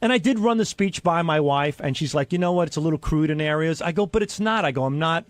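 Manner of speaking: ordinary delivery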